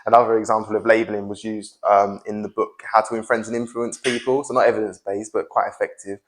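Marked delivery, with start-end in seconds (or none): none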